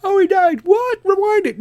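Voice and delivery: panicking in a high shriek over a missed scene; mock panic. Viewer missed the start after the ad, panicked squeal. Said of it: "You're like".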